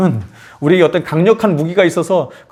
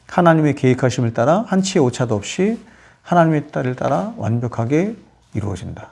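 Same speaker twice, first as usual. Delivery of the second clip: ordinary talk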